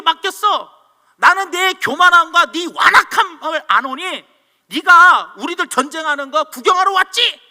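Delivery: loud scolding, acting the big brother; angry outburst. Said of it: "성질을 팍 화를 내버린 거예요".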